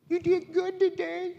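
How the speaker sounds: high squeaky put-on voice for the wife's compliment; husband mimics his wife saying he did well, in a high mock voice; mock wife praise. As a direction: pitch it way up, teasing the wife's "good job". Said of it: "she's like".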